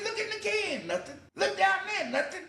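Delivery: very high, squealed protest; mock-pleading. Teen telling police about the trash can, pleading. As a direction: plead your innocence in a squeak.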